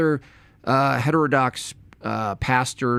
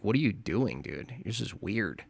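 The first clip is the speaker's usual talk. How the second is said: mock aside; 4 semitones lower, 10 dB quieter than usual. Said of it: "They're like".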